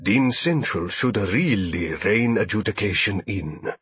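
smooth-talking alien merchant; ingratiating alien trader. A narrator's reading. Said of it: "He shuddered".

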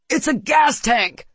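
high, loud, slow cry of alarm; alarmed gasp. A narrator's reading. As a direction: gasp out a sudden warning. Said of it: "Claris gasps".

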